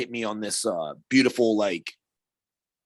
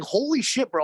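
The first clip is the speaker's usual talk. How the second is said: high, startled yelp of disbelief; shocked outcry; 11 semitones higher than usual. Retold or imitated. Player to himself after the goalie slashes his knee, shocked high yelp. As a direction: yelp it out like the slash just landed. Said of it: "I was like".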